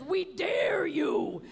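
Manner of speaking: slow, loud, hammed-up dare; mock dare shout. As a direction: bellow it like a menu taunt. Menu quote shouted slowly.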